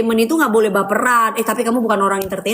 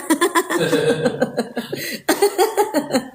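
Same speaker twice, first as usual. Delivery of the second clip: high giggle; giggling laugh; 6 semitones higher than usual. Real, not acted. Laughing between lines. (tertawa cekikikan)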